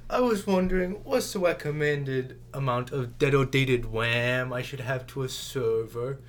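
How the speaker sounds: loud, high start that settles down; mock-earnest goofy voice; silly put-on voice. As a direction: ask it as a hammed-up parody character. Joining the silly voice parody.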